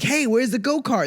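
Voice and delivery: high-pitched, eager mock question; acted eager query. Visitor asking mall staff for the go-kart, acted eager query.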